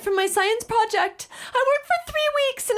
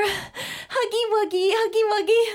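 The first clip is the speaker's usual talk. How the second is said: put-on baby-talk cuddle. Big sister cooing baby talk while hugging the toddler.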